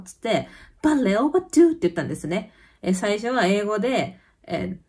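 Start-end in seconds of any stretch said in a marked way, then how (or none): 0.8-1.7 high, loud mock outburst in Danish; imitating an angry Danish retort. Woman who was hit snaps at the cyclist, high and loud. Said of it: "結構怒ったような感じで".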